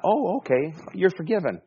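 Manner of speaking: high, breezy mock forgiveness; mock easy forgiveness. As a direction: toss it off as if it cost nothing. Mocks forgiving as if it were easy.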